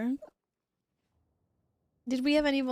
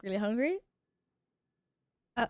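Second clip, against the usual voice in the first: usual speaking voice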